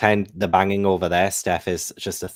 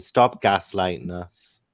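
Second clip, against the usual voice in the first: ordinary talk, nothing marked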